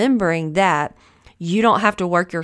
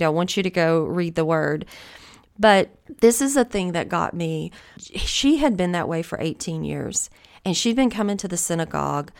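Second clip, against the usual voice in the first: ordinary talk, nothing marked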